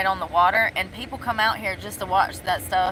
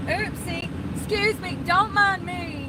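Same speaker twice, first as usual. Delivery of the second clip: mock apology; 9 semitones higher than usual. Mock apology to staring boys.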